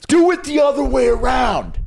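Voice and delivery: loud high shout. Real, not acted. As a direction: yell it out emphatically. Shouting at the movie premise.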